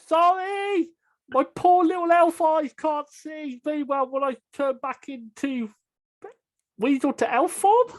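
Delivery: yelling down. Acted. Elf yelling an excuse down to companions, high apologetic call. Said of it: "I will yell, uh, down there".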